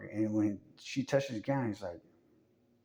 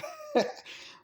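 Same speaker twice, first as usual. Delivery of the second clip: high laugh. Real, not acted. Laugh after the bit.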